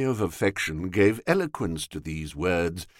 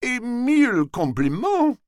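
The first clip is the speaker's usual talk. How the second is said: a light, gracious Gallic welcome; lightly cheerful polite reply; cheerily; 12 semitones higher than usual. Acted. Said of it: "he said cheerily".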